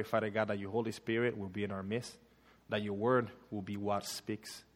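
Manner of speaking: ordinary talk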